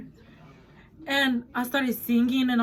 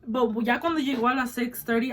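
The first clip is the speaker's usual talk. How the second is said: ordinary delivery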